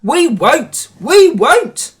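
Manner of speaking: shrieked chant of refusal; screaming trucks. A narrator's reading. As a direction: refuse like stubborn wagons. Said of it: "screamed the trucks".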